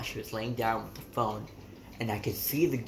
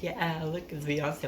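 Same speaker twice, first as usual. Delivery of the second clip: cheery deflection; 6 semitones higher, slower than usual. Retold or imitated. Cheerfully showing music to mom.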